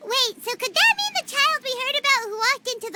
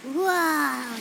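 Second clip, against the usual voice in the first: ordinary talk, nothing marked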